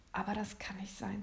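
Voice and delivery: hushed, doubting whisper. Acted. William doubting Santa among the elves, whispered. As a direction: breathe it out in disbelief.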